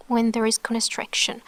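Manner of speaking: ordinary delivery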